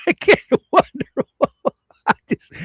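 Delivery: helpless laughter breaking up the words; real uncontrollable laughing. Real, not acted. Host can't stop laughing at the stupid people, laughing through the words. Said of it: "I cannot stop laughing".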